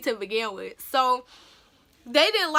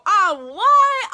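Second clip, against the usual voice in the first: sudden high, loud oh what; shocked gasp; 6 semitones higher, 6 dB louder than usual. Retold or imitated. Student hearing her name called, shocked. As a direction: caught off guard by her win.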